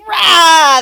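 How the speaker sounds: big demonstrative battle yell; acted rage roar. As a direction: roar it out like a raging barbarian. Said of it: "Like, you go".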